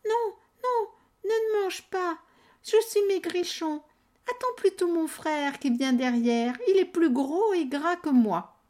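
high pleading goat voice; frightened little goat. Acted. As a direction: beg the troll in a tiny voice. Little goat begs the troll.

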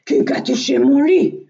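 big bear's loud outcry; loud, rising big-bear exclamation; Grand Ours. A narrator's reading. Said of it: "s'excria Grand Ours".

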